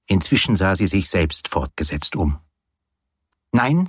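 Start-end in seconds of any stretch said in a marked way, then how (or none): 3.5-3.9 high-pitched quick no; teasing refusal. Acted. Woman forbidding her companion to look round yet, refusing.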